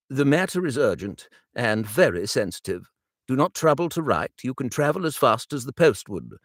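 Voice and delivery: a hint of an urgent older father.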